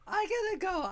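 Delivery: jumpy voice from hiccups; hiccuping. Real, not acted. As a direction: hiccup pushes the words out. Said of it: "I'm hiccuping like a crazy here".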